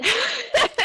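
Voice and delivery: high, loud burst of crying; sharp high tearful gasp; real sob. Real, not acted. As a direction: let a sob break out mid-thought. Overcome, says heart is breaking.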